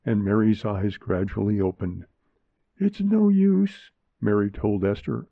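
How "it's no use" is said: put-on dying girl. A narrator's reading. Dying girl speaks to her mother.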